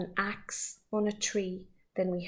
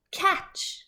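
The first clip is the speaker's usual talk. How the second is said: calling out; 10 semitones higher, 4 dB louder than usual. A narrator's reading. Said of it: "calls the Onceler".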